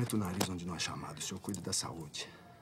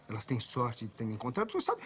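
ordinary talk, nothing marked

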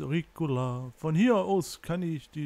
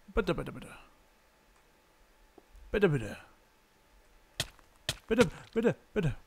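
pleading; 2 semitones higher than usual. Real, not acted. Gamer begging while jumping, tense pleading. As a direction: beg the jump to land.

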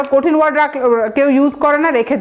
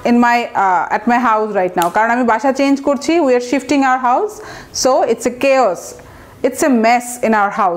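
example sentence; much slower than usual. Shown as a demonstration. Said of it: "একটা রুমে বল না".

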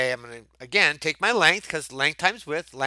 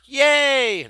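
cheer; 10 semitones higher, 7 dB louder than usual. Real, not acted. Celebrating correct answer.

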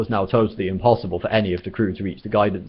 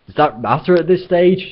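higher, louder desperate shout; 9 semitones higher, 6 dB louder than usual. Acted. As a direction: yell it over the din. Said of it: "shouted Arthur".

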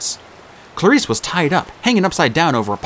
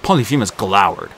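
ordinary delivery, nothing marked

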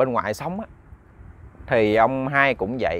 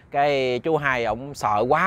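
ordinary delivery, nothing marked

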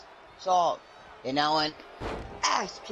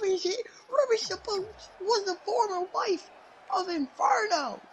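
much higher, near-crying disbelief; shocked disbelief. Real, not acted. Commentator stunned that Ruby entered, squealing. Shocked at Ruby's surprise entry.

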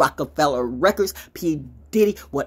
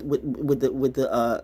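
normal talking voice

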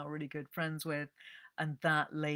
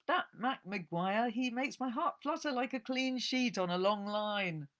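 put-on swooning old Nancy; 7 semitones higher, 4 dB louder than usual. Old woman gushing to the librarian about a hero, swooning. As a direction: swoon over the cowboy.

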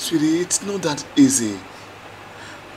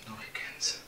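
breathless whisper; barely voiced, pleading; hushed no in the dark; whispered refusal; 17 dB quieter than usual.

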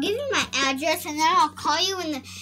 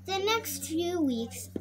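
ordinary delivery

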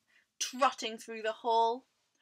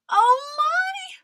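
high, loud mouse cry; alarmed cry; 13 dB louder than usual. A narrator's reading. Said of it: "cried Mice".

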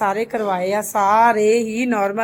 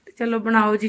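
ordinary talk

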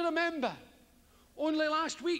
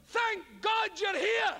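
mock relief; 7 semitones higher, slower than usual. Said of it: "come in immediately with".

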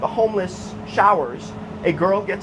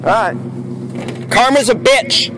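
gloating taunt; 2 semitones higher than usual. Real, not acted. Taunting the accused thief.

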